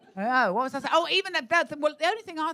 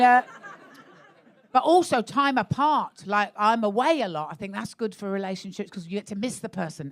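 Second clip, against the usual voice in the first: ordinary talk, nothing marked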